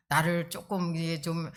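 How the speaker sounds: usual speaking voice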